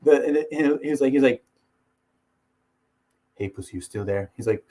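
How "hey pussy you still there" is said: low, quiet taunt; John Jones. Retold or imitated. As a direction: imitate a fighter needling his rival under his voice. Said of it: "John Jones versus D C".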